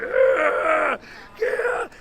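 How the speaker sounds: high, loud demonic wail; possessed screaming. Retold or imitated. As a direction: howl like someone possessed. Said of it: "I literally seen the devil's face".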